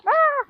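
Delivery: sudden high shriek of fright; startled scream. Real, not acted. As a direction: shriek in real surprise. Startled by something in coop.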